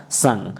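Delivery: संग said low and soft with a nasal ng ending; modelling the sound. Shown as a demonstration. Teacher showing students how संग sounds, low and soft. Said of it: "सा के साथ अंग का उच्चारण आएगा".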